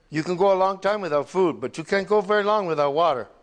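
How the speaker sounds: impassioned sermon on cleansing water; rising preaching. Acted. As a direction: drive the point home like a pulpit preacher.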